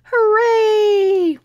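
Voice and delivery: high, loud joyful hooray; excited cheer. Acted. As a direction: shout it like kids who won. Parents agree, owlets cheer.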